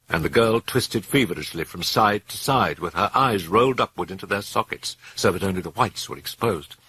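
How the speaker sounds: ordinary delivery, nothing marked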